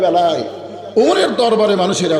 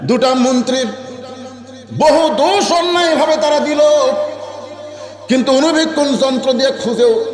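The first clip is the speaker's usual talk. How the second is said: high, ringing sermon chant; impassioned preaching; 10 semitones higher than usual. Acted. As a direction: preach it high, stretching the phrase endings. Preacher at full pitch.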